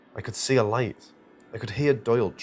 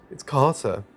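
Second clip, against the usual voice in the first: through tears; 5 semitones higher, 6 dB louder than usual. A narrator's reading. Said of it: "I said through my tears".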